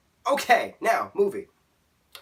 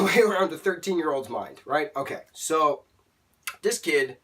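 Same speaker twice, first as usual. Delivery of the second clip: usual speaking voice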